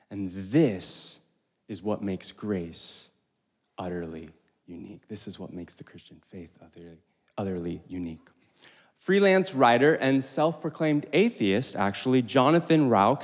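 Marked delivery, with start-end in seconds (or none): 0.1-8.3 hushed emphasis. Acted. Climax of sermon point.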